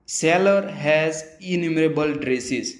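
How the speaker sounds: very slow, carefully spaced English sentence; slow example reading. Shown as a demonstration. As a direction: read the example slowly for learners. (धीमे-धीमे उदाहरण पढ़ना)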